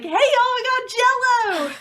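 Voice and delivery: put-on loud, high Southern twang, excited kid; a child yelling in a strong Southern accent; excited Southern kid shouting about Jell-O. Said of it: "slip into a Southern accent".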